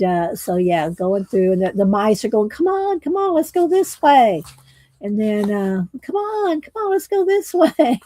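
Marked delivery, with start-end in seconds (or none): 2.5-4.4 higher little mouse, urging. Acted. Mice leading Leon. 6.0-8.0 much higher squeaky mouse breaking into a laugh. Acted. Mice voiced again, laughing.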